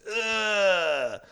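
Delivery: high, drawn-out crowd groan of disgust; a whole room going ugh. Retold or imitated. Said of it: "the audience goes".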